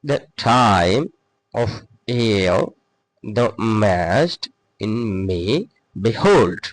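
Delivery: slow, measured stress on "time", "year" and "behold"; scansion demo. Shown as a demonstration. Teacher scanning the first line for students, stressed syllables drawn out. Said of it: "একটু জোর একটু বেশি দিব".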